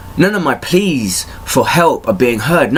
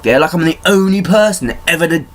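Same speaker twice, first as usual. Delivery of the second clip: ordinary talk, nothing marked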